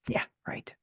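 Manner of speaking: dry whispered scoff; sarcastic whisper. Real, not acted. Author scoffing at her own hope in a sarcastic whisper.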